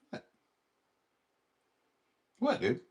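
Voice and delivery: normal talking voice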